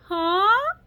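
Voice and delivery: high loud puzzled huh; imitating an American huh. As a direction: mimic a local who didn't catch a word. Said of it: "I thought it was rude".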